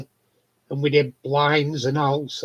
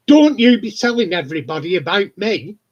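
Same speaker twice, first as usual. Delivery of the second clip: louder, like ticking someone off; loud telling-off; 3 semitones higher, 7 dB louder than usual. Retold or imitated. Woman telling someone off in the corridor, loud mock scolding. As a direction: imitate a loud, indignant scolding.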